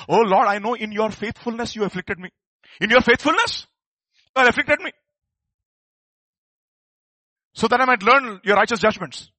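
loud, high, fervent preacher's cry; preaching at full force. Acted. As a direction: proclaim it to the whole church. Preacher quoting psalm at full force.

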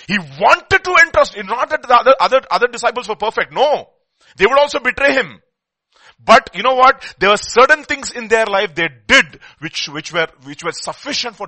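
thundering sermon climax; full-force preaching. Acted.

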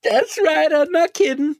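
squeaky high voice; high mock excitement. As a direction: answer in a high sing-song. Echoing the high voice.

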